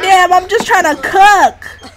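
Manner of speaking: annoyed sim. Retold or imitated. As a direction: voice a fed-up cook who keeps getting interrupted. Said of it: "Jiminy's like".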